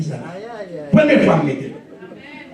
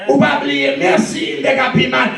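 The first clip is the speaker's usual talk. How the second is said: sermon crié, voix haute et forte; prêche enflammé; 5 semitones higher than usual. Acted. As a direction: exhorte l'église à pleine voix, en montant. Preaching at full force. (prédication à plein volume)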